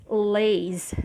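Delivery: lays drawn out slowly as the stressed syllable; slow lays in malaise. Shown as a demonstration. Teacher isolating the stressed syllable lays, slowly. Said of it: "the main stress".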